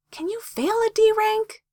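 very high, slow wondering question; high-pitched, drawn-out puzzlement. A narrator's reading. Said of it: "Sakura wondered".

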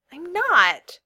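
high, loud exasperated denial. A narrator's reading. Girl denies it to her friend, exasperated. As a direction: protest in exasperation. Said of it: "she said in exasperation".

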